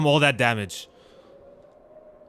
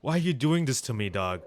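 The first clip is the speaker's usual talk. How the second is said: emotional; 4 semitones lower, 5 dB quieter than usual. Real, not acted. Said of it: "I'm getting emotional already".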